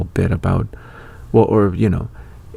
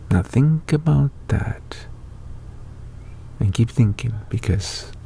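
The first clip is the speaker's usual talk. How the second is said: whisper; 3 semitones higher, 4 dB quieter than usual. Real, not acted.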